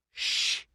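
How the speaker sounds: sharp unvoiced shh; hissed shush. A narrator's reading. Conan hushes the girl through the wall, hissing. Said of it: "he hissed through the apertures".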